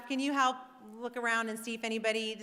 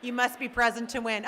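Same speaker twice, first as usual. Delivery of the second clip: normal talking voice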